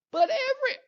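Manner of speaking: very high mock kid whine; squeaky little-kid protest; protesting child. Child arguing back, cut off.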